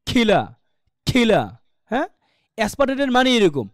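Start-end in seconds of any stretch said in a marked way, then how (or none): 0.1-1.6 "খিলা" with a strong breath burst on the K; aspirated with a push. Shown as a demonstration. Teacher explains aspiration as a push, breathy-burst "খিলা". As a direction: thrust air out on "খিলা", then let it fall. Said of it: "ধাক্কা হবে".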